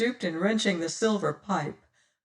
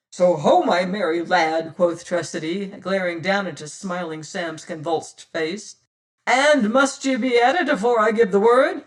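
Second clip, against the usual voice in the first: louder, mocking threat from a pirate captain; menacing pirate captain; 6 dB louder than usual. Pirate captain mocking his sprawled boatswain, loud and menacing. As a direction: taunt him while pinning him down.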